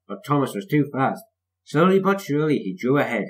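ordinary delivery, nothing marked

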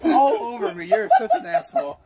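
high, laugh-crying words; laughing so hard it hurts. Real, not acted. Sprayed with water, laughing.

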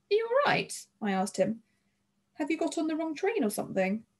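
high, tentative check on a stranger; concerned asking. A narrator's reading. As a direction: ask gently, pitched right up. Girl checks on startled young man.

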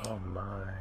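hushed awe; soft breathy whisper. Real, not acted. Echoes whisper.

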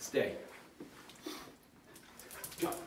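normal talking voice

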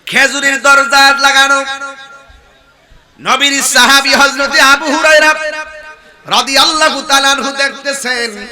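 loud, high chanted sermon delivery; full-force preaching. Real, not acted. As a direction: proclaim the story to a crowd. (উঁচু গলায় সুরেলা ওয়াজ)

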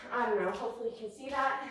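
ordinary talk, nothing marked

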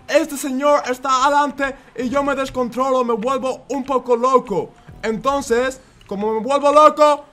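sustained high-pitched, frantic driving commentary; worked-up running commentary. Acted. Player talking himself through curves, high frantic commentary. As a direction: narrate every turn at a high pitch.

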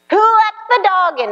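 mock outburst. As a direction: blurt it like home chaos.

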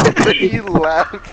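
high, loud words through real laughter; can barely get it out for laughing. Real, not acted. Cracking up telling friends how the driver just left, laughing.

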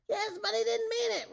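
very high, loud whine; squeaked excuse. A narrator's reading. Rumpelstiltskin backs out of his promise, very high loud excuse. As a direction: squeal the excuse far above normal pitch. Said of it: "Rumpelstiltskin mumbled".

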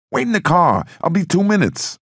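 high, loud barked order; Sonny shouting at his bodyguards; angry shout. Acted. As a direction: yell it at them from the stoop. Sonny yells at his men.

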